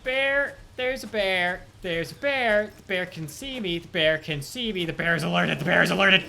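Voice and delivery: loud panicked shouting about a bear; panicked yelling. Real, not acted. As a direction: yell in real panic.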